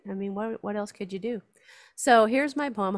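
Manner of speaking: usual speaking voice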